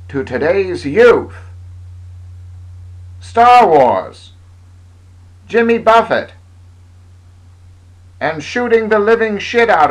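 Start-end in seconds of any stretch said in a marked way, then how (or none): none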